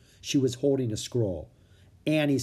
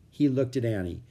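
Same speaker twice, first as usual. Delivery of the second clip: usual speaking voice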